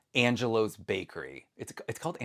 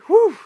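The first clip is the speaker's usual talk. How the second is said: loud high exhale of relief; relieved whew; 14 dB louder than usual. Real, not acted. Vlogger shaking off a scare, loud relieved whew. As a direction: blow out the leftover nerves.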